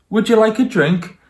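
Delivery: hospitable mummy. Acted. Mummy offering the tiger a sandwich, high sweet hostess voice. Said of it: "So Sophie's mummy said".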